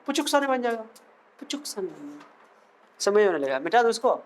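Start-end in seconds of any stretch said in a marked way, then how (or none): 0.1-0.8 high-pitched, playful lilt; high, cute teacher's quip; playful high take. Pretend. Playful line said two ways. 1.4-2.3 very soft, low echo; soft, dropped-down playful aside; hushed echo. Pretend. Same line repeated very softly.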